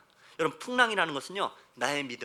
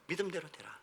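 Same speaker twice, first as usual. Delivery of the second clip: very soft, lowered scripture line; hushed echo; 10 dB quieter than usual. Acted. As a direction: let it sink in quietly. (나직하고 조용하게 되뇌듯)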